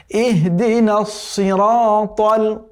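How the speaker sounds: slow, melodic tajweed chant drawn out at the end; chanted Quranic recitation. Shown as a demonstration. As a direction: recite the verse once, slowly, with full tajweed. Recites the verse.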